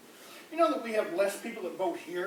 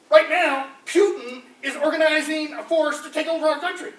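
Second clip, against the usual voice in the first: mock alarm; 7 semitones higher, 8 dB louder than usual. Comic rant about Putin.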